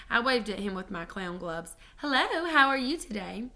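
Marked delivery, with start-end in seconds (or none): 2.0-3.5 high, bright friendly hello; sweet little greeting; chirpy greeting. Acted. Child greeting stranger.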